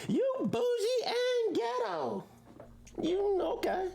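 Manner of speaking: high, teasing voice of a friend calling him out; mock friend teasing. Friend explains teasing word.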